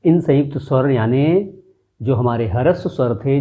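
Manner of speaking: ordinary talk, nothing marked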